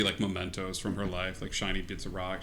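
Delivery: ordinary delivery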